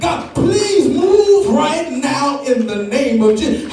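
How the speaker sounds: high, shouted "Please" then a slow, chanted plea; preacher voicing a kneeling believer's desperate prayer; pleading prayer. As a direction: cry out to God again and again. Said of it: "bow down on your knees and say".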